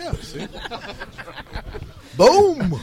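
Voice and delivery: loud, high triumphant boom; triumphant shout. Real, not acted. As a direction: shout it out like you nailed it. Drunk triumphant exclamation.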